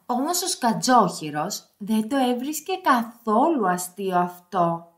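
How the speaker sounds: ordinary delivery, nothing marked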